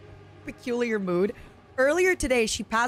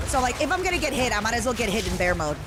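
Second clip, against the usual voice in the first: fast; faster than usual. Real, not acted. Rapid gaming chatter.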